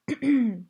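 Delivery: a firm throat clearing before speaking. Acted. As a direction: clear the throat to get attention. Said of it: "The preacher cleared his throat".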